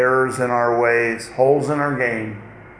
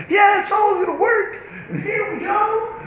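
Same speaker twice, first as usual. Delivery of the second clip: hammed-up, high-pitched eager cheer; mock excitement, pitched way up; over-excited. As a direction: gush it in a much higher, giddy voice. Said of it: "All excited".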